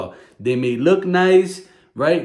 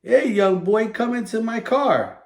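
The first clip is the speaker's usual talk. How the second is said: lightly played stranger, pitch a little higher; mock stranger luring; 7 semitones higher than usual. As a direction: sweet-talk the boy as a pretend stranger. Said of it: "I'm the stranger".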